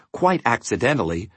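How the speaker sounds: plain reading voice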